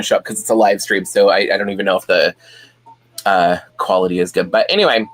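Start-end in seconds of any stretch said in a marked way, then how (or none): none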